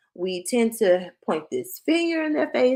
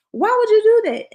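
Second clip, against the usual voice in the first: much higher, loud mock yell at a child; imitating mom yelling; 7 dB louder than usual. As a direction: mimic mom blowing up at her kid. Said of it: "my mom used to do all the time".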